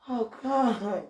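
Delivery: usual speaking voice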